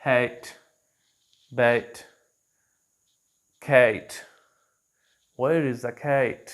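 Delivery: slow ae in हैट बैट कैट; careful native ae demo; कैट with the ae blend, drawn out; ae vowel words. Shown as a demonstration. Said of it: "इस तरह mix करते हैं ए और ई को".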